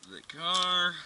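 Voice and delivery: ordinary delivery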